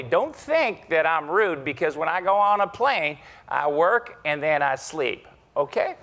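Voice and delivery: booming executive impression; John Chambers impression. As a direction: play the confident CEO inviting him along. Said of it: "So there's the Chambers imitation".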